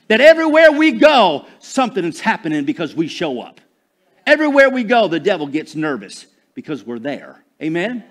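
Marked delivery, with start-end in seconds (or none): 0.1-1.4 loud, high declaration; rallying preacher call. Real, not acted. Preacher firing up God's people to swagger, rallying call. 4.2-5.1 raised, emphatic declaration; rallying preacher call. Real, not acted. Preacher telling church the devil gets nervous, rallying call.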